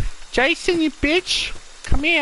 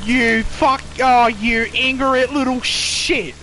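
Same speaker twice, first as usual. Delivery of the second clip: normal talking voice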